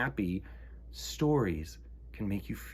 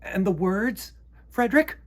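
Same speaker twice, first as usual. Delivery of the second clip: high, louder squeaky mouse voices; eager mice begging for more; 11 semitones higher, 6 dB louder than usual. Acted. Mice asking Frederick for words, high and squeaky.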